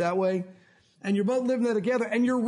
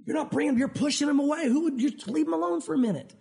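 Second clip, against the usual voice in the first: lightly voiced God correcting, gentle; gentle shushing; 5 semitones higher than usual. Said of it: "God has to say".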